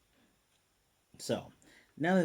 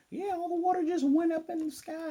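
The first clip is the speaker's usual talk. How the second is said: very high, squeaky imitation of onlookers; mock panicked reply; 4 dB louder than usual. Onlooker reporting the water shot up, mock panicked squeal. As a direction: retell it in a silly, sky-high put-on voice.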